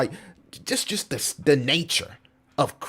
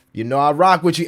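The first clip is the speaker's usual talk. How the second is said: louder, leaning on rock; louder emphatic; 3 semitones higher, 7 dB louder than usual. Real, not acted. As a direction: push it out louder to the viewer. Repeats line louder.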